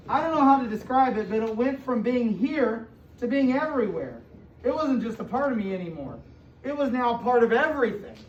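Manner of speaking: expressive storybook read-aloud to a class; reading to children. A narrator's reading. Teacher reading a picture book to her class, storybook voice.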